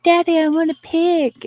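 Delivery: put-on high-pitched pleading kid voice; imitated little child. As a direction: ask like a little girl begging her daddy. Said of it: "She's like".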